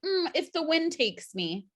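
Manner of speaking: breezy guest. Pretend. Period personified as breezy guest.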